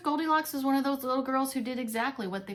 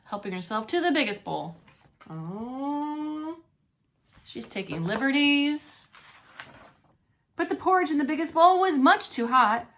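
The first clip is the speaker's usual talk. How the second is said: usual speaking voice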